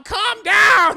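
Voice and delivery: high, very loud mock scream; hammed-up high-pitched yell; yelled plea. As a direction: shriek it, imitating a panicked girlfriend. Woman yells at man to calm.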